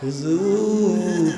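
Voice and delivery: soft, very slow drawn-out appeal; plaintive call. Acted. Weeping husband appeals to Omar, soft plaintive call. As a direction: stretch the word into a faint moan.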